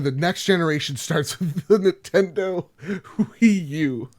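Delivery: announcement breaking into laughter; laughing quote. Retold or imitated. Host quotes Reggie's Wii U claim, laughing through it. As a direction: crack up partway through the proclamation.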